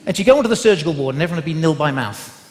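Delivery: normal talking voice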